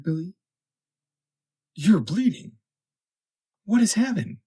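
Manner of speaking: put-on worried young woman asking questions. A narrator's reading. Florrie telling Denman of the shipwreck, earnest and concerned.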